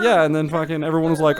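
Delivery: ordinary talk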